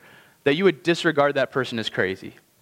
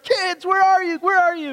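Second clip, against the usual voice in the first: much higher, overjoyed call through the house; excited calling out; 13 semitones higher, 3 dB louder than usual. Retold or imitated. George Bailey running home calling for his kids, overjoyed and high. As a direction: call for the kids like you can't wait to hug them.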